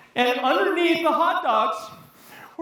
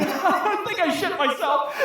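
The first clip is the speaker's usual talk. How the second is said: ordinary talk, nothing marked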